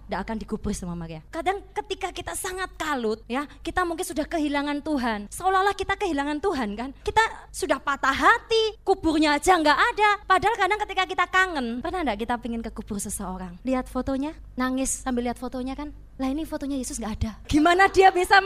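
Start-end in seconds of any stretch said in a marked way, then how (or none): none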